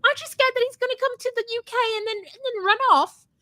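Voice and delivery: high, fast mock-worried lady. Retelling the stranger's warning on the show, high fast mock voice. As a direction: rattle off the warning in a high squeaky voice. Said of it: "the lady's like".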